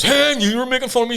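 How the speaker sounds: loud, high mock indignation; offended cleft-palate voice. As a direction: protest in a put-on cleft palate voice. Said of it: "I've got a cleft palate".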